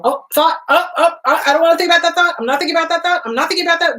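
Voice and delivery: high, flustered mock panic; frantic resistance. Someone fighting off an unwanted thought, mock frantic. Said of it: "when we resist a thought".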